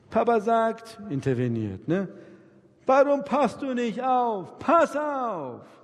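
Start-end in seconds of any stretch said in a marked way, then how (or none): 2.9-5.8 high, loud, slow father's scolding. Retold or imitated. Father scolds his crying child instead of comforting it, loud and high. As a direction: snap at the child for not being careful.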